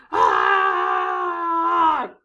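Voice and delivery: loud shrieking Ah; monster scream. Acted. Monster pupils scream back, a loud shriek. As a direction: let out a big monster scream. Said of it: "they scream right back".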